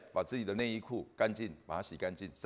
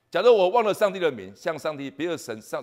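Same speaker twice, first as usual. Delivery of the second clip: much higher, louder outburst; preaching cry; 8 semitones higher, 12 dB louder than usual. Real, not acted. Preacher warns the church, crying out loud. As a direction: cry it out to the congregation.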